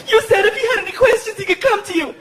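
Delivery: very high mock-female voice; indignant mother. Girlfriend challenges boyfriend over yelling, high indignant voice. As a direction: challenge him in a high, outraged voice.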